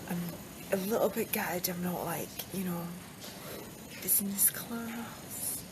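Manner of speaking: hushed whisper, barely voiced. Real, not acted. On a night flight, whispering to camera. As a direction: keep it to a whisper so nobody hears.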